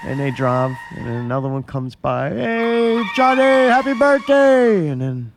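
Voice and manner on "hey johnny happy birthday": high, loud, drawn-out birthday yell; shouting. Retold or imitated. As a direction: call out slowly from a car. Cars shouting birthday wishes.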